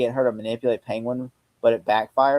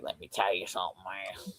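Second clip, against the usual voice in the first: fake Southern accent; 5 semitones lower, 13 dB quieter than usual. Comparing the villain's accent with another, soft mock Southern. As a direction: imitate a bad Southern accent under your breath. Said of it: "sounds more like a real accent than this one".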